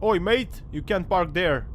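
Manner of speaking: loud mock telling-off; calling out. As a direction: shout it across the street. Calling out a parked car.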